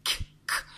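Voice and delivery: "k, k" as bare voiceless consonants; isolated phoneme. Shown as a demonstration. Teacher making pupils hear it, "k" sounded alone. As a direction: sound only the "k", twice. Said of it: "j'entends k".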